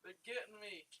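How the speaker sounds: high, loud alarmed cries; losing it as they rob you; panicked. Real, not acted. Being robbed in game.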